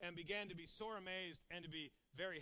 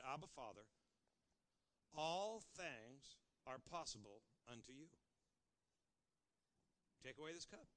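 hushed prayer; 6 semitones lower, 6 dB quieter than usual. Acted. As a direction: pray it quietly, close in. Soft reading of Jesus' prayer.

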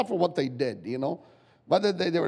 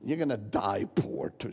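sly devil's voice; 4 semitones lower, 6 dB quieter than usual. Acted. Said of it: "Satan will start to say".

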